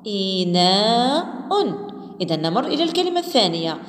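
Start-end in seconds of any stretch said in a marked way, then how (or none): 0.1-1.9 إناء drawn out very slowly, syllable by syllable; slow blending. Shown as a demonstration. Teacher blending the syllables into one word, very slow. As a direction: stretch إناء out so each part is heard. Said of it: "قوموا بدمجها".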